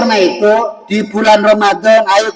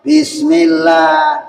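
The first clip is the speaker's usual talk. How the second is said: called out bismillah; 8 semitones higher, much slower than usual. Shown as a demonstration. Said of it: "Bismillah rame-rame". (Bismillah lantang dan lambat)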